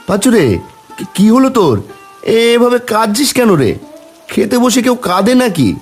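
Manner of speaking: high-pitched worried questioning; concerned alarm. Acted. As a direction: fret over a friend in tears. Friend alarmed at man crying.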